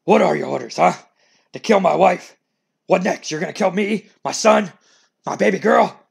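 loud, high, desperate outrage; furious Vince. Acted. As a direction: rage rising toward his family. Vince confronting wife's killer.

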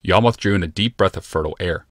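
ordinary delivery

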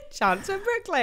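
high laughing repeat. Real, not acted. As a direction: giggle through the words. Co-host echoes it laughing.

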